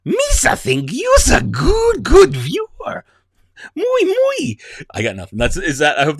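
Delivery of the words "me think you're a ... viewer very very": much higher, slow, loud goofy Jar Jar voice; hammed-up Jar Jar Binks. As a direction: shout it out as a goofy Gungan. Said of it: "do a Jar Jar Binks impression".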